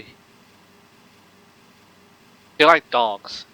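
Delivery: put-on lilting Irish accent on a question. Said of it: "that's my Irish accent".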